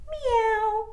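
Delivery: high, playful kitty meow; cat meow. Shown as a demonstration. Teacher showing kids the cat sound, high meow. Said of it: "what does the cat say?".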